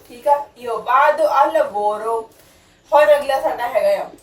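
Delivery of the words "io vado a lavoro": deliberate, unhurried Italian; slow model sentence. Shown as a demonstration. Teacher slowly models the alternative sentence. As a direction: lay each word down slowly. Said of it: "ਜਾਂ ਫਿਰ".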